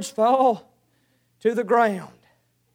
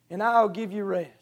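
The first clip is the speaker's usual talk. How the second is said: tender; 5 semitones lower, 8 dB quieter than usual. Acted. Christ's invitation, softened.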